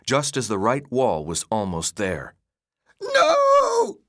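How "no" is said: loud, piercingly high scream; long, desperate wail of refusal. A narrator's reading. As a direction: scream out in utter terror. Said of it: "he screamed again".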